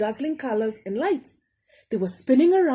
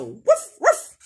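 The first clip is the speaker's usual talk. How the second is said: a louder mock woof woof; mock bark; 6 semitones higher, 6 dB louder than usual. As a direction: bark it like a bird pretending. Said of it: "it wasn't a dog barking".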